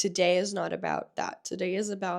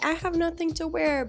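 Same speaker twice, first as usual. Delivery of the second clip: dramatic movie whine; 10 semitones higher than usual. Retold or imitated. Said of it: "characters in movies that said".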